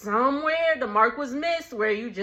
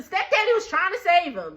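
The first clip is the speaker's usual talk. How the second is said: ordinary delivery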